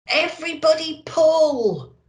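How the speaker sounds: high, loud command to heave; rally the reindeer with a loud call; urgent shout. Acted. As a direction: Santa shouting to his reindeer to pull. Santa urging reindeer to pull.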